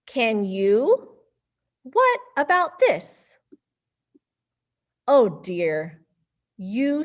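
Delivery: put-on lively storybook voices for kids; reading to children. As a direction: read it playfully to little ones. Reading picture book to kids.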